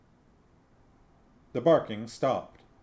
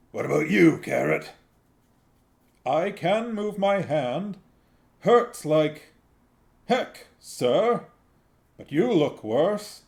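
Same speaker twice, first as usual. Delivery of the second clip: put-on higher and louder, earnest young guard; young Carrot; 6 semitones higher, 7 dB louder than usual. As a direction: voice Carrot high and strained through pain. Carrot voiced by narrator.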